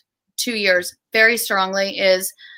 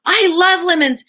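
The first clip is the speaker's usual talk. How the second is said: put-on much higher, gasping child's delight; excited toddler; 9 semitones higher than usual. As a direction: play the three-year-old thrilled by the lemons. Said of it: "she goes".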